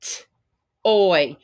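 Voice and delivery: "T-" then "oy" split apart, each part said on its own; sounding out toy. Shown as a demonstration. Teacher sounds out toy for first graders, parts split apart. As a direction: break "toy" into "T-" and "oy". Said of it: "So let's spell the word toy".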